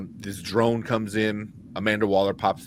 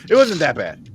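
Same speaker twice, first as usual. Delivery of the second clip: louder, higher insistence; emphatic; 5 semitones higher, 8 dB louder than usual. Real, not acted. Defending the movie to friends, insistent. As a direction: insist it really wasn't bad. Repeats line, first take pushed.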